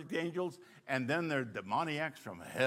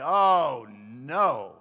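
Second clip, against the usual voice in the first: dismayed groan; 3 semitones lower, 7 dB louder than usual. Retold or imitated. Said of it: "I would say".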